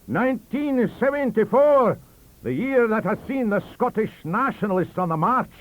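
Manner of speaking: high, loud rallying speech; fervent oration. Acted. Professor hailing Scottish nationalism, loud and fervent. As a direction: rouse the Scots at the table.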